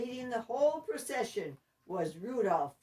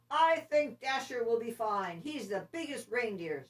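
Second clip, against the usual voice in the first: hearty, louder Santa voice, a touch higher; 5 semitones higher, 4 dB louder than usual. Acted. Santa picks Dasher for the team, hearty and loud. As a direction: Santa choosing his sleigh team. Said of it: "said Santa".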